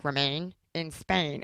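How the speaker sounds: slow, soft pet voice; dog voice. Retold or imitated. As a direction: coo it slowly to the dog. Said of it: "I'll be like".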